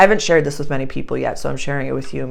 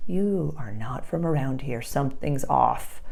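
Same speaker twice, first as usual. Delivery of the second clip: hushed, wary imitation of suspicious locals; soft mock suspicion, slowing at the end; 7 dB quieter than usual. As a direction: drop the volume, as if sizing up an outsider. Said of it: "people would be like".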